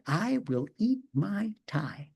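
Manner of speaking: slow, breathy whisper aside. Retold or imitated.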